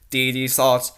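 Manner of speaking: ordinary delivery, nothing marked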